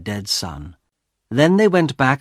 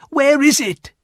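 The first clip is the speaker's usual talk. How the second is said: high-pitched eager question; urgent demand; 4 dB louder than usual. A narrator's reading. Wife presses husband for the paw, urgent.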